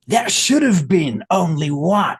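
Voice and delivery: slow, loud, dramatic tagline read; movie trailer voice. Pretend. Pitching his sequel, slow epic trailer voice. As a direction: boom it out like a trailer announcer.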